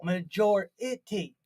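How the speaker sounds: MAJORITY drawn out slowly as ma-JOR-i-ty; slow, careful MAJORITY with JOR stressed; second-syllable accent. Shown as a demonstration. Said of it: "The accent's on the second syllable".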